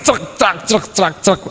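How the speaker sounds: high, rhythmic hacking noises; imitated rapid chopping. Preacher mimics hacking on at the trunk, rhythmic sound effect. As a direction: mimic blow after blow on wood.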